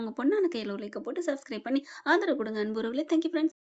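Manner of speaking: ordinary delivery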